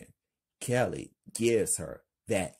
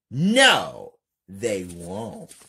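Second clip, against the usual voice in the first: yelling; 10 dB louder, much slower than usual. Acted. Lena defying Kelly, sudden loud shout then calm. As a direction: bark the no, then settle. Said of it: "Lena yells".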